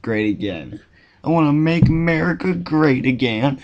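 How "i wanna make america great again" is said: hammed-up Southern accent, higher-pitched; bad Southern accent. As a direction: put on a badly faked Southern twang. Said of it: "talking in a bad Southern accent".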